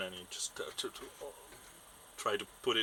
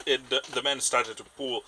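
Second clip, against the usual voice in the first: usual speaking voice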